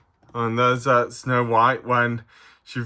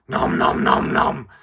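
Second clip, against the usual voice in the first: munching creature voice; 8 semitones lower, 3 dB louder than usual. Acted. Puppet Stripe answers.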